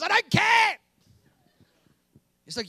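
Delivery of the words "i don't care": higher, louder, brushing it off; mock petulant young man; dismissive retort. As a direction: snap back with a shrug. Himself answers God.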